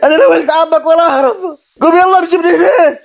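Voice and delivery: high-pitched angry disowning; heated outburst, pitch held high; furious rejection. Acted. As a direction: cut off all pleading in anger. Rejects pleas, disowns his cousin.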